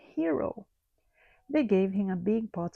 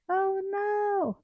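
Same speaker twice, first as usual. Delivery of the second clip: high alarmed cry; frightened cry; 11 semitones higher, 3 dB louder than usual. A narrator's reading. Bees hearing the bear smash the school, frightened. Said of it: "they cried".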